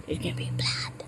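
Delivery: a creepy little whisper; mock ominous whisper; spooky whisper. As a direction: whisper it like a warning. Spooky aside about scary movie.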